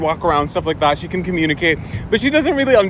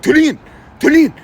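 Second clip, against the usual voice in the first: loud, raised grandpa bellow; stern calling out; 8 semitones higher, 4 dB louder than usual. Retold or imitated. Grandpa at window to toddler, loud stern call. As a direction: call sharply and order the child.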